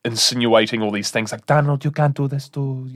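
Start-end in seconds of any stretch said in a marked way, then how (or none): 1.5-2.9 put-on foreign accent, heard as Mexican; Melania Trump. Wife nags Donald about his behaviour, foreign-accented Melania Trump. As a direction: imitate a foreign wife pleading, in a muddled accent. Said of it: "that's my Melania Trump".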